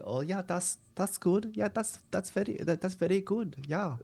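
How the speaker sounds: mock German accent, high-pitched, W's as V's; imitating a comic's German dictator voice; Hitler impression. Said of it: "it's more of a higher up".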